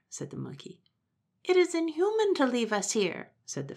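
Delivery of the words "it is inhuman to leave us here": much higher, fluttering bird voice; indignant pheasant. Acted. Pheasant protesting to Momotaro, high-pitched.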